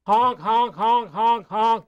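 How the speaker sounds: goofy goose honks; silly clown honking. Acted.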